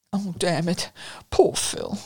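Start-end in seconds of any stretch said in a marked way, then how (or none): 1.3-2.0 whispered tearful sigh. Acted. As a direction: choke up on his name. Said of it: "burst into tears".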